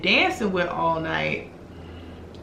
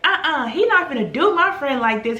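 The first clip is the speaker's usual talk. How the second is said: mock indignant; 7 semitones higher than usual. Acting out the indignant friend.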